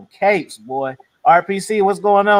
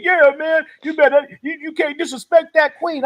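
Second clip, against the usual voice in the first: much higher mocking voice of a simp; mock defender; 9 semitones higher than usual. Mocking the other guys.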